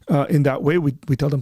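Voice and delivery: usual speaking voice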